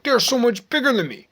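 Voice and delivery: high, quick mock complaint; hammed-up quote in a high, hurried voice; imagined complainer. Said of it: "most people I think in my situation would be like".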